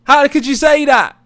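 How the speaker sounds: hammed-up high, loud indignant yelling; shrieky put-on outrage for the algorithm; mock outraged yapping. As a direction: fake a shouted drama row at full pitch. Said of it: "the more I yap and go".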